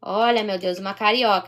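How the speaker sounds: ordinary talk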